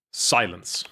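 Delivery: put-on low, stern young master order; low stern command. Shown as a demonstration. Testing a low voice for the young master's order. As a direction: growl it like a cold boss. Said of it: "I can't do that voice".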